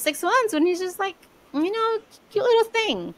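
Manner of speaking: high-pitched, cooing fondness. Real, not acted. Aunt recalling her baby nephew, gushing fondly. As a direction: pitch climbs high as you coo.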